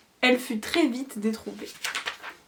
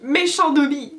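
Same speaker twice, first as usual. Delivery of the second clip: high, loud self-scolding elf cry; shouting at himself; 5 semitones higher, 7 dB louder than usual. A narrator's reading. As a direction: cry out while punishing himself. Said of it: "en criant".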